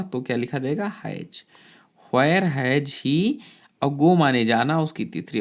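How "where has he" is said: slow dictation. Shown as a demonstration. Teacher dictating a sentence.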